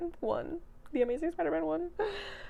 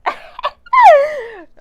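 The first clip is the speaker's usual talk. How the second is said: loud, high-pitched giggle burst; burst of laughter; 18 dB louder than usual. Real, not acted. Vlogger laughing at her own cheeky joke, loud giggle. Flustered joke about wet pants.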